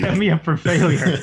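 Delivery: high, loud mock outrage; mock outraged shout. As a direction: yell it in mock betrayal.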